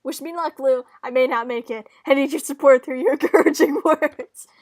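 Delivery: loud, dramatic appeal for support; melodramatic, projected begging; theatrical plea. Acted. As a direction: ham it up, calling out at full volume. Sister theatrically demands encouragement.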